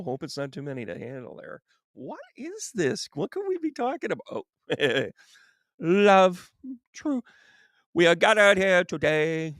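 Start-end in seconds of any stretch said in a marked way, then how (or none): 1.9-4.5 coy teasing. Acted. Teasing reveal of love card. 7.9-9.5 slow, louder mock wedding officiant. Joking to Tracy about marriage, mock officiant intoning.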